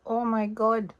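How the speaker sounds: high, soft mock Spanish-accented woman; attempted Spanish accent. Woman gushing to her date, mock Spanish accent. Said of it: "I can't do the accent".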